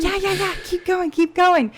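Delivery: encouraging. Retold or imitated. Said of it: "I'm like".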